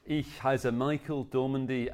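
ordinary talk

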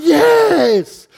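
high, drawn-out cheer; crowd's shout. Acted. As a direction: answer like an excited crowd. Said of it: "And the crowd shouted".

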